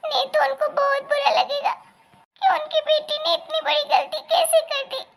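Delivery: high, fumbling, giggly excuses; a flustered bride laughing nervously through her excuses; nervous young daughter-in-law. Acted. Caught daughter-in-law stammering excuses to her in-laws, high and nervous.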